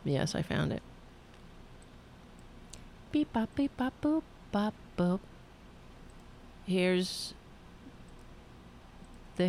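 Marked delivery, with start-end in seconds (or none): 3.1-5.2 beep boop robot. Pretend. Searching computer, mimics machine noises.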